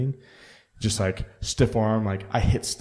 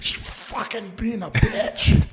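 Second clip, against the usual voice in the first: high, slow, whiny mock complaint; a slow, high grumble about the dog; grumpy retort; 12 semitones higher, much slower than usual. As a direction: drag the words out like a cranky whine. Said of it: "I'm like".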